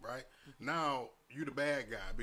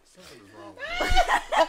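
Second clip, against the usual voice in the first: high shouted laugh through words; 17 dB louder, slower than usual. Real, not acted. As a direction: shout it while cracking up. Friends laughing about coworker.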